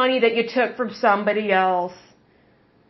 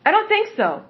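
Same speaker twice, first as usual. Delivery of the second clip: sarcastic higher echo; higher mocking repeat; 6 semitones higher than usual. Shown as a demonstration. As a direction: repeat it pitched up, scoffing.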